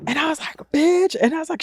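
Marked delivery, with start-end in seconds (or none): 0.7-1.2 high-pitched disbelieving cry. Retold or imitated. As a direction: squeal it at her, starstruck.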